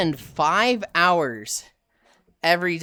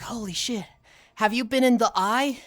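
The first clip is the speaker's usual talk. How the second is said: mock starstruck newbie, higher; hammed-up starstruck newbie; awed gushing; 6 semitones higher than usual. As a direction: gush like an awestruck fan. Newbies gushing over raid veteran.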